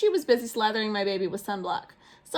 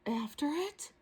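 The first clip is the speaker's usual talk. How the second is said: soft whispered question; 5 semitones higher, 8 dB quieter, slower than usual. Acted. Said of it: "we all whispered".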